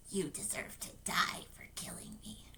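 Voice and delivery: very soft, menacing whisper. Acted. Dead George condemning his brother, whispered. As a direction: whisper the accusation close up. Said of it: "George whispered".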